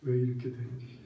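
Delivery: a speaker whose voice has just gone rough; preacher apologizing as his voice suddenly fails; voice giving out. Real, not acted. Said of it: "좀 아까까지 정말 목소리가 좋았는데".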